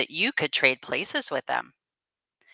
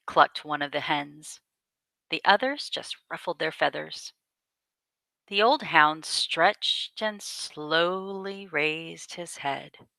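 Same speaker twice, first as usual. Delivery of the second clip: ordinary delivery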